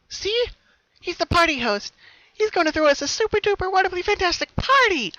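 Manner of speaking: very high, loud gushing; thrilled Pinky. Acted. Pinky to her sister about the host, thrilled. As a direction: show off the host excitedly.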